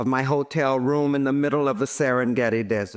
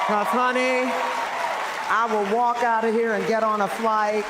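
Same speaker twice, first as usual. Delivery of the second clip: sassy diva; 7 semitones higher than usual. Real, not acted. Diva teasing the audience.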